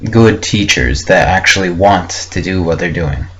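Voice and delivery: normal talking voice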